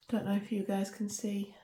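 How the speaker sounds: soft whispered aside; whispering. Real, not acted. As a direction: whisper it under your breath. Aside while showing the mould.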